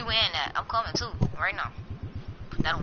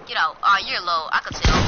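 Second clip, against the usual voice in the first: usual speaking voice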